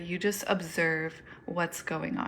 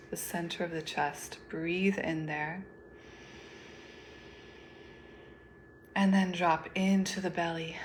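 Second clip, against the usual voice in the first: guided meditation. Acted. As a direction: lead them gently through each breath, unhurried.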